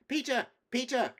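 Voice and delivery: high, loud calls of a name; excited cry. A narrator's reading. Edmund cries to his brother Peter, excitedly calling out. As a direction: call out urgently, pitch leaping up. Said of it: "cried Edmund".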